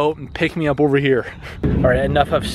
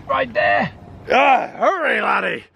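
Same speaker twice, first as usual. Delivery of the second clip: gruff pirate urging on his crew; 6 semitones higher, slower than usual. Pretend. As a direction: growl it like a sea captain. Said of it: "my pirate voice has kind of been losing its steam".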